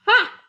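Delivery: a high, loud mocking "ha"; scornful ha. A narrator's reading. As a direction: bark a contemptuous ha. Scornful laugh at dust speck.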